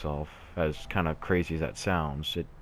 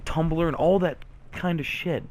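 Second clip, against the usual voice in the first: pitched high, urgent and insistent; impassioned plea; 10 semitones higher than usual. Real, not acted. Vlogger begging sad viewers not to self-harm, impassioned plea. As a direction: plead with them, voice raised in pitch.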